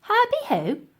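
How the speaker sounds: high, confused splutter; spluttering old lady. Acted. Dotty baffled by the word, high spluttering. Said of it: "spluttered the lady".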